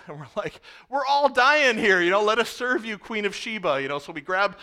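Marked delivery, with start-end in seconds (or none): 0.9-3.8 sarcastic exasperation. Retold or imitated. Sarcastic retort to complaining girl.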